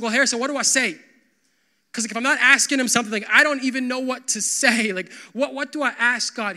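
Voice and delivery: quick, loud, flustered asking; mock worried churchgoer. Unsure churchgoer asking the pastor, loud and hurried. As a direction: play the lost churchgoer asking for help.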